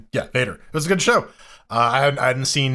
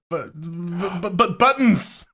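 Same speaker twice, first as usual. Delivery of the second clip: flustered stammer; 6 semitones higher, slower than usual. Retold or imitated. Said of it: "I was like".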